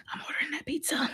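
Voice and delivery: secret whisper, breathy and hushed; conspiratorial whisper about pizza. Acted. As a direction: whisper it like a sneaky confession. Reads chat in hushed aside.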